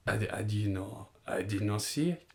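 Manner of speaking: soft, halting manager impression; lightly imitated evasive interviewee; Arsene Wenger. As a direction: plead ignorance quietly, in careful foreign English. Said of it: "when Wenger got interviewed".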